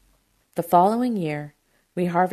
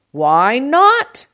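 very loud, high demand; shouting; 7 semitones higher, 11 dB louder than usual. A narrator's reading. Uncle challenges produce man, shouting. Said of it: "my uncle shouted".

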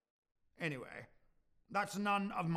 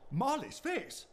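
startled; 8 semitones higher than usual. Acted. Scrooge at his door, suddenly higher in a startled cry. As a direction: blurt it out in fright. Scrooge sees face in knocker.

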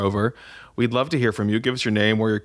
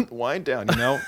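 ordinary delivery

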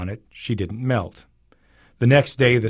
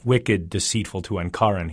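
usual speaking voice

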